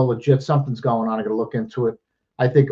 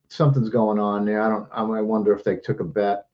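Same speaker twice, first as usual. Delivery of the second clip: ordinary talk, nothing marked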